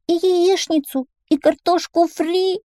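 put-on very high, slow child voice; little boy. A narrator's reading. A boy remembering food at home, small high voice. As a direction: pipe up like a wistful little boy. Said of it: "добавил один мальчик".

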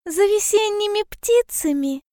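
high, eager small-animal voice; delighted little hedgehog. A narrator's reading. Hedgehog overjoyed at bear's travel idea, high delighted voice. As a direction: ask it high with happy surprise. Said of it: "обрадовался ёжик".

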